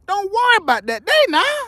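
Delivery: high, teasing brush-off; playful scolding. Acted. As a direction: wave off the nosy ones. Playful scolding nosy audience.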